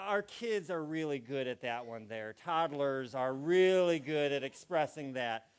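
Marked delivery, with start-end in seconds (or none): none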